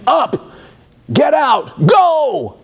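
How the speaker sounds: high shouted orders; commanding shout. Acted. Pharaoh commands the Israelites out, shouting high. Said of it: "he's commanding them".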